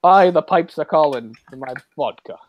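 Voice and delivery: hammed-up Scottish brogue; a Scot badly passing as a Russian, Scottish accent intact; Sean Connery. Joking as the vodka-loving sub captain, in Scottish brogue. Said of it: "Sean Connery, making no attempt".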